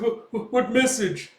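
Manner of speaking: high, stammering frightened ask; panicked question. Acted. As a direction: fumble the words in panic. Thug panics under interrogation.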